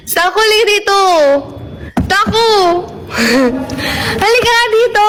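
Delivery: high, cutesy coaxing; put-on high pleading; baby talk. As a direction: call someone over in a high baby voice. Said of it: "Gusto mo, ibibitok kita?".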